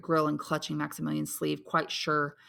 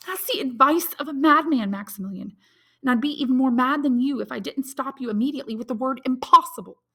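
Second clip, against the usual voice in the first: put-on higher, distressed young woman's voice; young woman Valentine; 9 semitones higher, 7 dB louder than usual. A narrator's reading. Valentine confides her bad news to Maximilian, anxious and higher.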